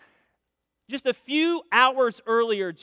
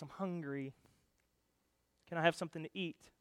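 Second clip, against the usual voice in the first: small meek request; 4 semitones lower, 13 dB quieter than usual. Acted. Revived little girl asks for food.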